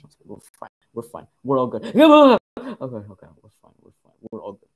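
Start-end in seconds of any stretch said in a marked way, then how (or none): 1.9-2.7 loud, high-pitched startled outburst; a sudden yelp of a laugh, high and loud; startled cry. Real, not acted. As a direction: burst out loudly in nervous shock. Startled outburst during horror game.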